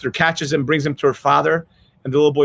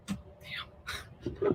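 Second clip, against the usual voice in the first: choked up; 18 dB quieter than usual. Real, not acted. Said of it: "So I'm getting choked up".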